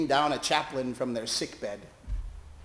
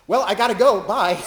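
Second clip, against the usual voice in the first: much higher, louder flustered goodbye; hasty exit; 6 semitones higher, 9 dB louder than usual. Retold or imitated. Chaplain escaping the humiliating preacher, loud hasty goodbye. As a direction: blurt it out while bolting.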